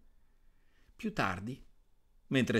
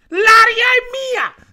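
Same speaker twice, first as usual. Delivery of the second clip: very high, loud, slow shriek; shrieking Lidia; 17 dB louder, slower than usual. Acted. Lidia shrieks to her brothers that the air is hers, high shriek. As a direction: squeal the claim to the sky. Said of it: "strillò Lidia".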